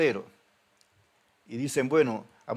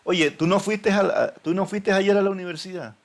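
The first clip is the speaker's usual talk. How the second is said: incredulous question; 6 semitones higher, 5 dB louder than usual. Retold or imitated. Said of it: "en la vida real dice".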